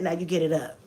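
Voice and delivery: low, quiet no-nonsense order; retold grandma's understated sternness; stern grandma. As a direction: lay down the command softly and firmly. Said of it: "and she said".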